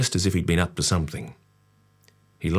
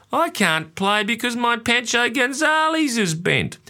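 put-on high, slow, whining small boy; youngest child whine. A narrator's reading. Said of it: "in my youngest child whine".

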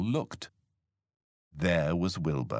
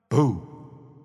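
loud, higher-pitched boo; startling boo; 6 semitones higher, 6 dB louder than usual. A narrator's reading. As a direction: burst out to make them jump. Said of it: "to make them jump".

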